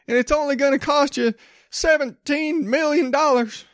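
high, hammed-up preacher pitch begging for money; mock televangelist. Comedian mocking a televangelist asking for church money, high hammed-up preacher voice. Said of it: "to rip off a joke from Sam Kinison".

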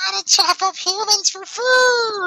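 high, slow squealing goblin cry. Acted. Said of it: "Goblin voice".

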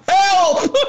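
high squeaky pretend plea for help; mock cry for help. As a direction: yelp it like a scared kid. Mock cry after tough-guy banter.

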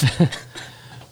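stifled giggles. Real, not acted. Giggling fit mid explanation.

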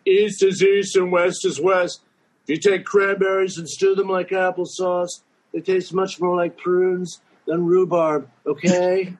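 high, rambling Trump impression; Trump word salad. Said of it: "his distortion field word salad".

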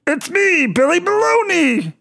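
very high puppet self-introduction; Billy Baloney. Acted. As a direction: announce yourself in a squeaky-high puppet voice. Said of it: "another one like Billy Baloney".

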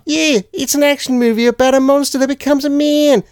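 high-pitched, hammed-up Aussie storyteller; Australian accent. Host reading the writer's quote, high exaggerated Australian accent. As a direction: push it up high in broad mock Australian. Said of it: "gonna do an Australian accent".

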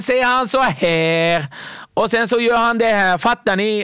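high, loud, slow comedian delivering stolen punchlines; Wahlbeck on stage. Retold or imitated. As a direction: pitch up and milk the crowd, slowly. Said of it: "Han bara". (hög, högljudd och långsam Wahlbeck på scen som säljer in skämten)

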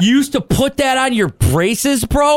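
high, slow shocked disbelief; can't believe what you're hearing. Real, not acted. Shocked at braces battery story.

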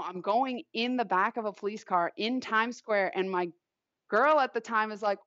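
usual speaking voice

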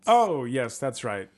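ordinary talk, nothing marked

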